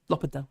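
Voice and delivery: L'Hopital said the French way, no h and the s dropped; L'Hopital with the letters left out, French style; French pronunciation. Shown as a demonstration. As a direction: say L'Hopital like a French speaker, lo-pee-tal. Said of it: "they leave letters out".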